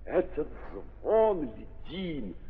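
ordinary talk

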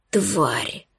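hoarse wheezed insult; rasping strained mutant. A narrator's reading. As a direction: wheeze it out in pain, low and soft. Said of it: "просипел он".

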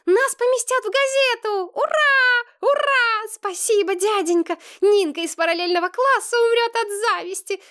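put-on very high-pitched little girl squealing with happiness; high, gleeful child voice, bursting with joy; delighted squeal. A narrator's reading. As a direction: squeal it like an overjoyed schoolgirl. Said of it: "заверещала Маша Сергеева и запрыгала на месте от счастья".

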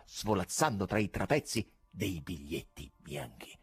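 plain reading voice

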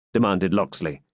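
plain reading voice

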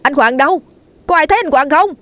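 high, loud panicked calling; frantic shout. A narrator's reading. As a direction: call out desperately for a missing man. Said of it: "Khánh la lên".

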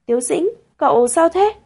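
a touch of tentative concern; careful inquiry. A narrator's reading. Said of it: "mới cẩn thận dò hỏi".

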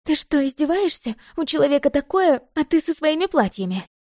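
high, indignant scolding; exasperated outburst. A narrator's reading. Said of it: "не выдержала Оля". (возмущённый упрёк высоким голосом)

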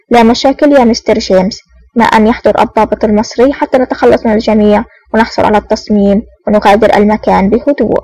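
ordinary delivery

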